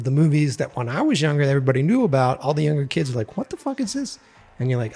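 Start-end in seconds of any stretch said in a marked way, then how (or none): 3.4-4.2 put-on confused kid voice. Younger kids react to old movie.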